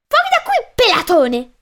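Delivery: very high-pitched, enraged ferret yell; furious shriek. Acted. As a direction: shriek it in an unnatural fury. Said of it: "urlò con furia innaturale".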